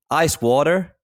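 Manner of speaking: lightly Americanise "water"; toned-down American accent. Shown as a demonstration. Expat showing what you have to say, light American accent. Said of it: "put on the accent".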